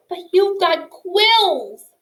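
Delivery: protest, indignant; mouse protests. A narrator's reading. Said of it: "Poppy protested".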